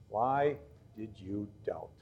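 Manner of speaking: slow, low and very soft; hushed reproach. Acted. Jesus to the sinking disciple, a hushed, slow reproach. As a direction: drop right down and draw the question out.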